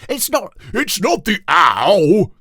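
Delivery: loud, high, slow stern correction; king sternly correcting Ketchup Face. A narrator's reading. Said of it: "said King Toothbrush Weasel sternly".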